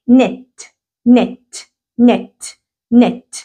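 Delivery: "نت" said as net, the k dropped; silent k demo. Shown as a demonstration. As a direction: start "نت" straight on the n. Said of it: "كلمة زي كلمة متماسك". ("نت" من غير نطق ال K)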